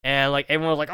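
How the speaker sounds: ordinary delivery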